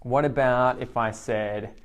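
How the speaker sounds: ordinary talk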